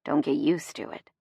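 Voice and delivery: low, soft gruff grumble; grumbling. A narrator's reading. Chase pulls Gemma from the door, grumbling. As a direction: grumble it low, only half serious. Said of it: "he grumbles".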